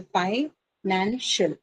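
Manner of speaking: "pi, nan, shall" as three separate syllables. Shown as a demonstration. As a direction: break it into "pi", "nan", "shall" with gaps. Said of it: "Just say the syllable".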